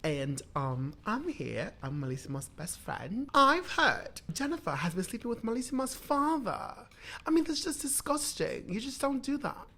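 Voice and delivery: hammed-up British interviewee; scandalised gossip in mock British accent; best UK accent. Melissa's best friend reporting gossip, mock UK accent. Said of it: "give me your best U K accent".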